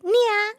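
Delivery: a high, cutesy "Nya" like the cat-glove people; imitating nya. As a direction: chirp "Nya" high and cute, mocking. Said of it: "you know how those people do that".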